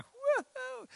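very high little whoop; mock elation. Doctor given money for his next ticket, high joyful whoop. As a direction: squeal it like unexpected good news. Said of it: "the doctor was like".

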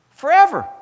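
loud, high shouted forever; preaching shout. Real, not acted. Preacher hitting the peak of his point, loud and high. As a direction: belt out forever.

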